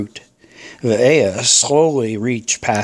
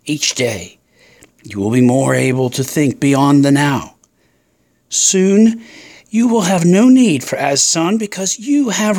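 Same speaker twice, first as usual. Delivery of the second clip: taunting serpent; 4 semitones higher than usual. Acted. Serpent promises the pair reason, commanding then lulling. Snake tempter speaks to pair.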